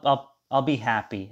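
normal talking voice

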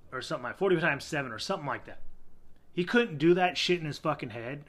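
ordinary talk, nothing marked

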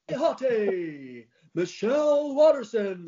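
slow, drawn-out, high American announcer voice; mock American accent. Shown as a demonstration. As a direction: stretch out "karate hottie" in a put-on American accent. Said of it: "you can only say that in American".

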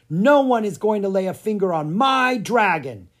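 loud, high, indignant outburst; defiant declaration. A narrator's reading. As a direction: declare it boldly, shielding what is yours. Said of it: "he declared".

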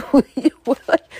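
high, loud, slowed startled blurt; startled confusion. Retold or imitated. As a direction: act thrown off and confused. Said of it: "I'm just like".

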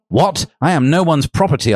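story-reading yell, held back; mock yell. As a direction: read an indignant yell without really shouting. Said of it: "I yelled".

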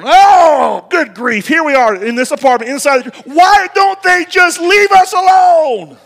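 exasperated outburst. Retold or imitated. Retold outburst at knock.

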